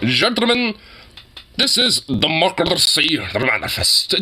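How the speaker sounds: lightly imitating a thick, grandiose declaration; mock-grand, thickly pronounced oratory; thick pronouncement. Imitating the drunk man addressing gentlemen, thick pronouncement. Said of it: "he just says it so thickly".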